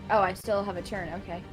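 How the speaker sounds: ordinary delivery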